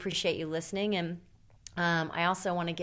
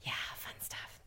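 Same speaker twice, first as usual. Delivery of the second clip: whisper; 13 dB quieter than usual. Real, not acted. Gossip aside.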